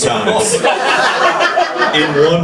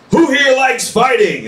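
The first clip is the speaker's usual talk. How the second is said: high announcer call to the crowd; 9 semitones higher than usual. Retold or imitated. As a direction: pitch it up like a fair announcer. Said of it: "looks around and goes".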